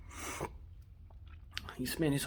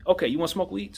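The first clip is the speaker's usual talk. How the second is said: ordinary talk, nothing marked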